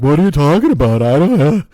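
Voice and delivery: indignant imagined employee; clueless staff. Retold or imitated. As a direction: play the staffer who lost the order. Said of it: "they're like".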